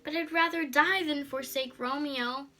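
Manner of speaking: put-on desperate young girl. A narrator's reading. Juliet's desperate plea voiced.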